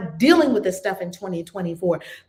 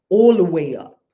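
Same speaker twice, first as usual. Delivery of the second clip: put-on New York accent; 9 dB louder than usual. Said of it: "my New York accent".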